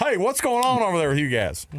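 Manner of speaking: high, dopey mock Southern voice; Southern accent. Mocking an opponent in an argument, Southern accent. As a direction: play it dumb in a Southern accent. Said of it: "a Southern accent and lobster hands".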